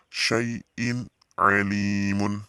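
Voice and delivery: SYAI'IN ALIMUN slow, distinct n; clear recitation. Shown as a demonstration. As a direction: model Syai'in alimun, stretching the li. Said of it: "bacanya jelas ya".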